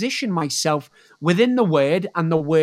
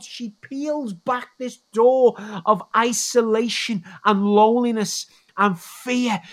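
rising preacher voice; 4 semitones higher than usual. Acted. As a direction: preach it up with fervour. Preaches the woman's story.

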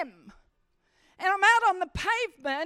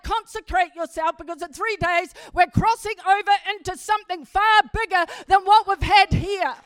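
preaching proclamation; 3 semitones higher, 8 dB louder than usual. Retold or imitated. Joshua's call to Israel.

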